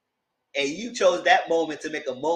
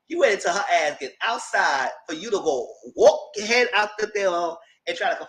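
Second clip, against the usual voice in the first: ordinary talk, nothing marked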